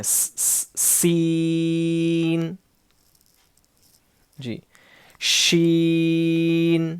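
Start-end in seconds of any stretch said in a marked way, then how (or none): 0.0-0.7 unvoiced whistling hiss of s. Shown as a demonstration. Teacher isolates the whistling s sound. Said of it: "سیٹی کی آواز آنا ہے". 0.8-2.5 slow سین with a firm hissing whistle on the s. Shown as a demonstration. Teacher demonstrates a whistling letter, slow. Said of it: "سیٹی کی آواز آنا ہے".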